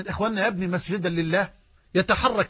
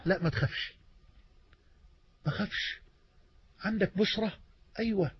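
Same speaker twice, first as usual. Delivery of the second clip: much softer, almost whispered comfort; gentle reassurance; 9 dB quieter than usual. Real, not acted. Preacher calming a frightened believer, hushed and tender. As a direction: hush his fear gently.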